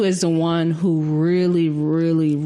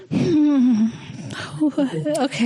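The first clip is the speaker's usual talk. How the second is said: high, doubtful hmm then a laughing okay; skeptical hmm; 8 semitones higher, much slower than usual. Retold or imitated. Answering the brother's pep talk, high doubtful okay. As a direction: sound unconvinced but amused.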